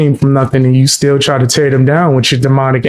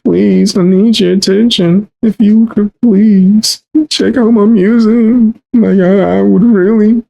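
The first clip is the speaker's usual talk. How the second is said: mock pleading whine; 6 semitones higher than usual. Mocking a plea for attention.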